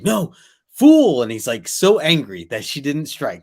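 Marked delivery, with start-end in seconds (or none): 0.0-1.2 high, loud furious yell; furious shout. Retold or imitated. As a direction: bellow it at her in rage. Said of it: "and he's just like".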